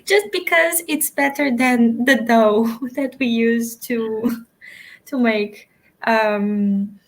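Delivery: giggling through the words; laughing. Real, not acted. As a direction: talk while cracking up, can't stop. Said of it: "I can't stop laughing now".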